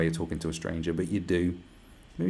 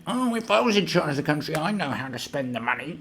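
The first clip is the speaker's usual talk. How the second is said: much higher, whiny know-it-all voice; mocking a know-it-all; 8 semitones higher than usual. Mocking people who think they could run the country, in a whiny voice. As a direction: sneer it like a smug armchair politician. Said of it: "there's people, like, going".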